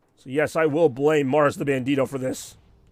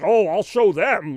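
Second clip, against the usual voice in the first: mock determination; 5 semitones higher, 8 dB louder, slower than usual. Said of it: "Am I supposed to be like".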